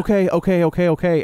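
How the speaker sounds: mildly flustered, retold; flustered agreeing. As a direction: imitate someone anxiously going along. Retold flustered compliance in film scene.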